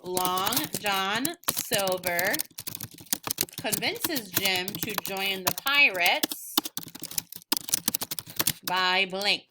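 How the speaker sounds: very slow, stretched answer frame; teacher modelling a sentence starter; slow dictation. Shown as a demonstration. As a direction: dictate it word by word for copying.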